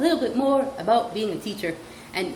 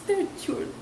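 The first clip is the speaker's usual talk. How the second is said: normal talking voice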